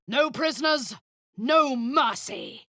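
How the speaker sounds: rally them for slaughter; battle cry. Acted. Commander rallying troops before battle, shouting.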